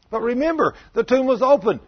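high, loud urgent insistence; eager plea. Acted. Disciples pleading with Thomas.

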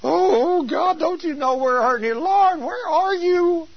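hammed-up desperate plea to God; begging and pleading. Preacher acting out a household pleading with the Lord, wailing begging. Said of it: "They're begging and pleading".

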